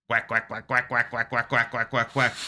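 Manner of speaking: rapid, even string of quacks; duck quacking. Retold or imitated. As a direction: imitate a flock of quacking ducks. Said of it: "bunch of ducks in the background".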